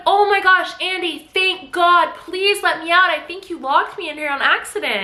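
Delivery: high, relieved pleading voice; relieved wife. Retold or imitated. Locked-in wife begging husband to open up, high and relieved. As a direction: plead up high, grateful to be found. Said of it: "And Nina's like".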